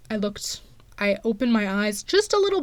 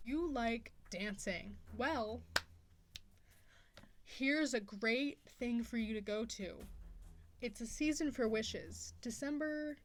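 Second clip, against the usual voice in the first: soft, quieter promo voice over music; ad read; 15 dB quieter than usual. Acted. Senior plugging her benefit show to viewers, soft ad read. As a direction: pitch the show to friends gently.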